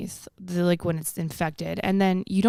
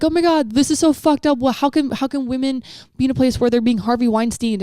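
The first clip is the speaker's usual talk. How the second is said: higher, louder mock outraged whine; mock outrage; 6 semitones higher, 5 dB louder than usual. Mocking people who are shocked at the news, in mock outrage. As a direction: ham up the shocked indignation. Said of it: "we're like".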